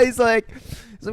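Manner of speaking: ordinary delivery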